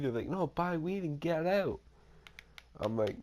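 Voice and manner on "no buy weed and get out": mock cussing. Storyteller imitating the gang rejecting him, mock cussing. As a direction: imitate an irritated rebuff, pitched up. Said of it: "fucking start cussing at me".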